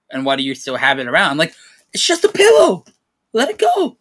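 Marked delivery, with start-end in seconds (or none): 1.9-4.0 much higher put-on voice, dismissive; high mocking voice. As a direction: squeak it out like her brushing it off. Girlfriend's dismissive words acted out.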